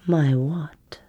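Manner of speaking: teasing whispered question. Acted. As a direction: whisper it mockingly.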